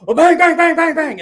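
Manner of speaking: loud, high, rapid-fire banging noise; imitated door pounding. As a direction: hammer the words out like fists on a door.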